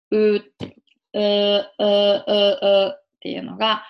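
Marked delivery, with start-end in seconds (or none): 0.1-2.9 loud, repeated dark L hums; L sound. Shown as a demonstration. Teacher drilling L for learners, repeated L sound. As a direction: say "うー" with tongue tip behind upper front teeth. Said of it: "Lの音になります".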